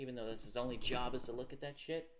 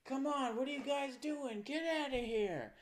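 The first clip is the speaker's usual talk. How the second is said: high, raised mock yell; mock panicked movie character; 11 semitones higher, 3 dB louder than usual. Movie character urging the crowd to flee, mock urgent yell. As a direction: holler it like an overacted film extra. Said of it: "this one character who's like".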